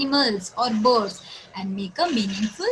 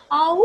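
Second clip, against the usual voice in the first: long, high "awooo"; imitated wolf howl; 6 semitones higher, 5 dB louder than usual. Shown as a demonstration. Teacher shows children what wolves do, mock howling. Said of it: "It howls".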